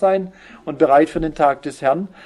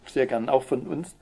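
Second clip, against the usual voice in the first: ordinary delivery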